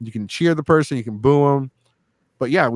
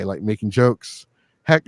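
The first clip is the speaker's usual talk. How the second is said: normal talking voice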